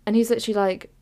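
usual speaking voice

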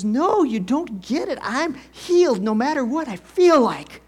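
imitating wife's loud, high-pitched insistence; excited. Wife insisting to husband he doesn't get it, excited shouting. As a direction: burst out emphatically, punching each phrase. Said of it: "called me up at work all excited".

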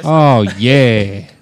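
silly put-on accent for man-bun Colin. Playing man-bun Colin being flattered, in a put-on accent. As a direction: coo teasingly in a put-on accent. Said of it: "What is that accent you're doing".

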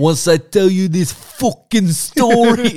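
loud, high, drawn-out mock-Italian accent; over-the-top Italian. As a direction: belt it out as a hammed-up Italian wise guy. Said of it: "Straight up Italian".

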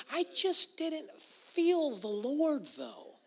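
high, slow, drawn-out protest; mock complaint. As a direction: stretch it high, mimicking a put-upon churchgoer.

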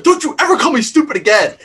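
high, loud, furious retold comeback; angry retort. Patrick to Heather, a retold angry high outburst. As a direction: snap it back like he's insulted. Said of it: "he was so pissed".

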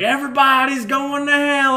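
high, loud hammed-up preacher; preacher's shouted warning, hammed up; mock preacher shout. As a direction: yell doom like a caricatured preacher. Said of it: "and he's like".

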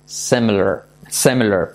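English "similar" with the I as E; low demo of "similar", first vowel an E; I pronounced like E. Shown as a demonstration. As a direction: say "similar" as "SEM-ler". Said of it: "Con I como E".

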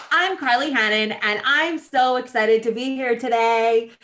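hammed-up peppy self-introduction; bubbly Valley girl. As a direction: gush like an overexcited newcomer. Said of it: "I was this bubbly person".

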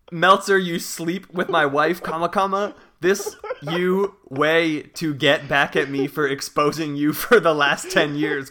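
hammed-up tirade with punctuation read out; mock all-caps rant. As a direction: rant it loudly, fighting back laughter. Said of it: "he says in all caps".

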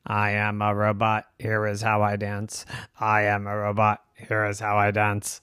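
low, monotone robot. Acted. Robots announcing their dance, monotone robot voice. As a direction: drone it evenly like a robot.

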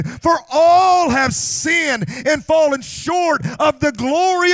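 loud, high shouted preaching that builds; preacher pleading with the church to own their sin, shouting; full-force preaching. Acted. Thunder it at the congregation.

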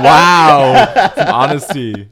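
high, loud laughing disbelief; laughing mock shock. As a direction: cackle out the mock praise. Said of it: "I was like".

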